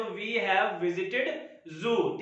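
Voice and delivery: slow dictation. Shown as a demonstration. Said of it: "हम चिड़ियाघर घूमकर आ चुके हैं".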